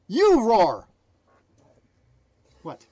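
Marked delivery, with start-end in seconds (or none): 0.1-0.9 loud roar. Acted. Motivational roar command.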